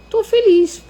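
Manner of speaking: put-on child's bright, high, loud "I'm happy"; high happy answer. Daughter answers her father on the beach, high and happy. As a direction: chirp it out like a happy little girl.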